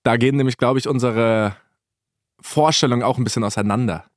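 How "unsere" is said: usual speaking voice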